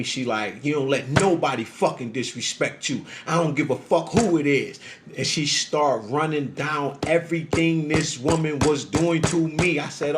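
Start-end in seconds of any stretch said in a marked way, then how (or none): none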